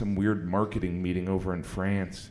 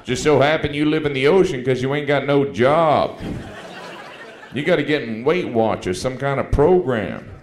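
loud, high mocking heckle; heckler from the audience; 4 semitones higher, 7 dB louder than usual. Rude audience member jeering at Ricky, loud and mocking. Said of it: "mean people in the audience".

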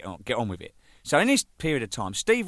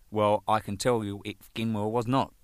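ordinary talk

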